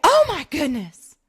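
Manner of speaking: a slow, lightly Southern gasp of delight; Southern accent. Retold or imitated. Imitating the DMV clerk amazed at the photo, light Southern accent. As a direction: drawl it out in a light Southern lilt. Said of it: "Is she a Southerner?".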